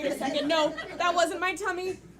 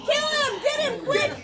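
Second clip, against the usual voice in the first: urgent shouting; 5 semitones higher, 6 dB louder than usual. Acted. Monster attack, urgent shouting.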